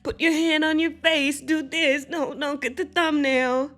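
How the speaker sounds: high-pitched mock directing mother; mimicked bossy mom. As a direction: imitate her fussing over the shot. Mimics mother ignoring son.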